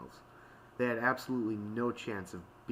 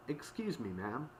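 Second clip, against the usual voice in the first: higher, airy polite request; politely getting someone's attention; 4 semitones higher, 3 dB quieter than usual. A narrator's reading. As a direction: put on your politest airy tone. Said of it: "her most airy and polite tone".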